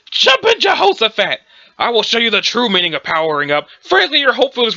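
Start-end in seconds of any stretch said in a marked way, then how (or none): 0.1-1.4 put-on old man exclamation. Cornball exclamation by the grandpa.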